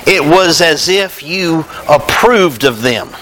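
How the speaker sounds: usual speaking voice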